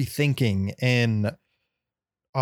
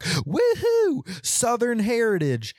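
much higher, hammed-up yee-haw voice; mock the flag wavers' cheer; 10 semitones higher, slower than usual. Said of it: "and they're like".

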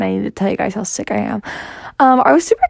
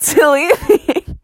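ordinary talk, nothing marked

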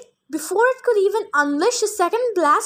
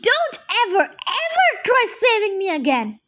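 high, furious warning shouted; angry shouting; 5 semitones higher than usual. A narrator's reading. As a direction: jab each ever at him. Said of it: "Clarice screamed".

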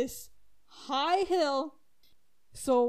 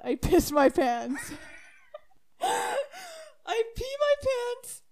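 words squeezed out through laughter, higher and slower; can't hold back the laughing; laughing hard; 12 semitones higher, slower than usual. Real, not acted. Laughing at own mishap.

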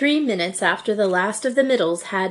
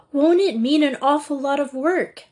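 doubtful; 7 semitones higher, 3 dB louder than usual. A narrator's reading. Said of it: "asked Margia doubtfully".

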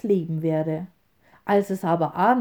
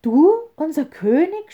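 high, loud indignant outcry; furious bird crowd; angry scream; 7 semitones higher, 6 dB louder than usual. A narrator's reading. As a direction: shout in scornful disbelief. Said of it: "schrien die Vögel zornig".

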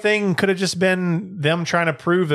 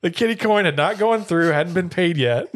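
laughing; 4 semitones higher than usual. Real, not acted. Laughing at joke.

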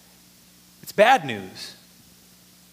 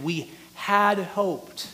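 slow phrase, weight on "had"; stress on "had"; much slower than usual. Retold or imitated. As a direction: draw it out, leaning hard on "had". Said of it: "had" language again".

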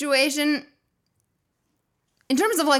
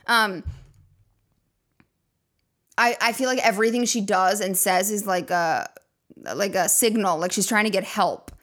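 ordinary talk

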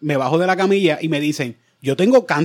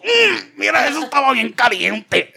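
very high strained voice; high pained complaint; 9 semitones higher, slower than usual. Retold or imitated. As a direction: squeak it out in pain. Complaining about hot water.

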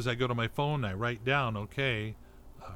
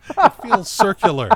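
laughing; 10 semitones higher, 12 dB louder than usual. Real, not acted. Laughing at podcast about podcast.

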